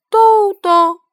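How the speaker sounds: high, loud call; child calling to the dog on the grass. Acted. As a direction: shout the name across the lawn. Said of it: "朝着小狗喊道".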